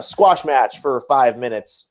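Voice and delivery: ordinary talk, nothing marked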